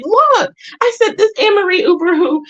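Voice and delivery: excited. Retold or imitated. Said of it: "I told my daughter".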